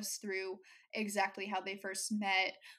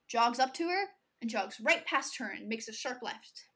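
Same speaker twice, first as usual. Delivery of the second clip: ordinary talk, nothing marked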